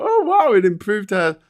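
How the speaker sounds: high gasping mock wonder; a hint of a gullible reader; lightly mocked amazement; mock gushing reader. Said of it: "Everyone who reads that's gonna go".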